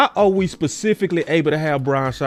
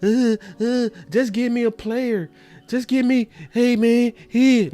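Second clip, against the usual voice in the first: higher, hesitant mock of a vague coach; mock vague Parker; 8 semitones higher than usual. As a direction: whine it like a clueless rookie coach. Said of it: "that old".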